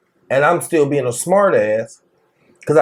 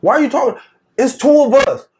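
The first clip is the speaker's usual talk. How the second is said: exasperated retort; 8 semitones higher than usual. Retold or imitated. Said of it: "We like".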